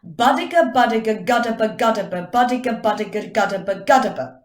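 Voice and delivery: rapid tongue-twister drill, loud and punchy; brisk, rhythmic articulation exercise; back to back. Shown as a demonstration. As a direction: fire the syllables off without a gap. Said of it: "back to back".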